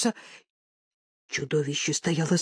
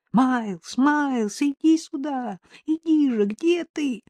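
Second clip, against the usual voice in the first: high, pleading call; desperate calling; 5 semitones higher, 3 dB louder, slower than usual. A narrator's reading. Owners calling lost Miles to come, desperate. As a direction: coax and beg the lost pet to come. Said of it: "Их выдавали отчаянные голоса".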